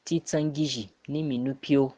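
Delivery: ordinary talk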